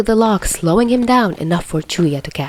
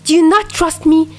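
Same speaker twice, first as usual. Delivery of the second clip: higher, rapid string of hurt questions; pressing him for answers he can't give; confused and hurt; 9 semitones higher, 4 dB louder than usual. A narrator's reading. Hurt friend firing questions at silent Dazai, confused and upset. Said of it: "he asked, confused".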